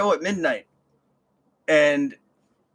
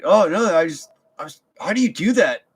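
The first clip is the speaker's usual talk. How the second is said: excited fan; 4 semitones higher, 6 dB louder than usual. Retold or imitated. Fan gushing about scream.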